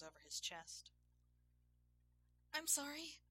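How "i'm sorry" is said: high, louder squeaked apology; frightened squeak. A narrator's reading. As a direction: squeak it out, much higher than narration. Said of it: "Rebecca squeaked".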